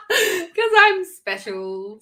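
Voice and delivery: high, loud and drawn out through laughter; giggly, slow mock boast; laughing self-mockery. Real, not acted. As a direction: stretch it out, laughing at yourself. Laughing at own odd pronunciation.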